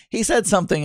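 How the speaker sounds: ordinary talk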